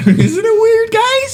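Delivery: very high-pitched, laughing question; mock bewilderment. Joking with friends about the breakup, squeaky mock bewilderment. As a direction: squeak it in fake puzzlement. Mock wonder at why she left.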